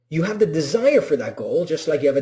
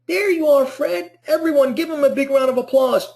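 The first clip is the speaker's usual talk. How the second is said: much higher and louder, a cheering host welcoming Fred; excited greeter; 10 semitones higher, 4 dB louder than usual. Retold or imitated. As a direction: call him in like a thrilled emcee. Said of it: "they're like".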